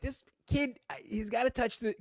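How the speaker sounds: high, strained exasperation; dad fumes about his son touching the flosser; exasperated thought. Retold or imitated. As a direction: squeak it out, fed up with the kid. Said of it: "now I'm like".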